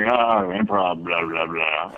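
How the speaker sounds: low, dismissive grumble; mock disdain. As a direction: imitate scornful comics, low and unimpressed. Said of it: "a lot of comics don't like improv".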